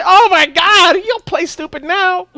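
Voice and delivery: high, loud mock outrage; indignant outburst. Patient scolding the nurse after the swab, mock outrage. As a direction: exclaim in high-pitched disbelief.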